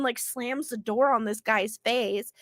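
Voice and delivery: usual speaking voice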